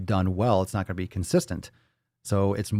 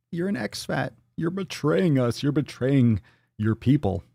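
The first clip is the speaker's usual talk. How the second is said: mock accuser; 5 semitones higher than usual. Voicing offended people.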